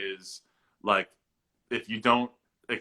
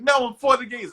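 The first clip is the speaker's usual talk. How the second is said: much higher, louder eager mom; imitating mom; 11 semitones higher, 9 dB louder than usual. Mom correcting her son's misunderstanding, an eager much higher voice. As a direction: cheer it out as a proud ally mom. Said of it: "She's like".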